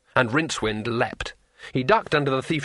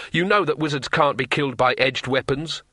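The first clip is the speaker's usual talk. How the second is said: ordinary delivery, nothing marked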